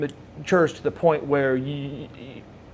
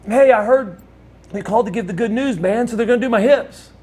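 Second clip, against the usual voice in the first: raised, upbeat patient voice; cheerful good news; 7 semitones higher, 3 dB louder than usual. Retold or imitated. Paralyzed Lex telling his friend about the hips, excited. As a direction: share the news high and hopeful.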